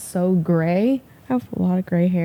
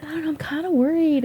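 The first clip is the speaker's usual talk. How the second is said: worried mom; 9 semitones higher than usual. Retold or imitated. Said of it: "my mom was like".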